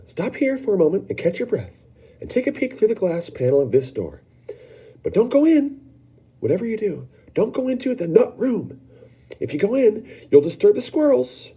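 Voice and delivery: an eccentric host fussing over his squirrels; Mr Wonka warning. Acted. Wonka warning the children off the door, high and excited. Wonka warns about the Nut Room.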